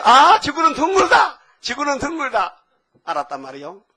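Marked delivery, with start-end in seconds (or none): 0.0-2.6 triumphant shout. Acted. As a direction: shout it like a great discovery. Columbus discovers the earth is round. (발견의 기쁨에 찬 외침)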